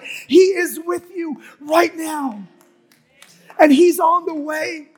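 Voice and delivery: high, driving preacher's crescendo; impassioned preaching, building to shout. Real, not acted. Preacher insisting God is with you no matter what, shouting.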